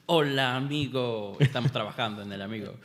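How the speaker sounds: imitating an American student's greeting; mock gringo accent on amigo. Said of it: "tengo un estudiante que comienza las clases".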